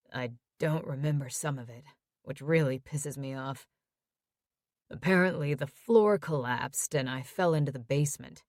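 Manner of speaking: quiet, tired plea from a hospital bed; injured son. Acted. Tyler voiced by narrator.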